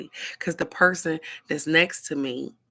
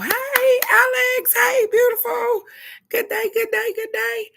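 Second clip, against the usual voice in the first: much higher, louder sing-song sweetness; sweet morning greeting; 6 dB louder than usual. Pretend. Wife acting the cheerful greeting she wants from her husband, high and sing-song. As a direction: chirp it like a cartoon-happy spouse.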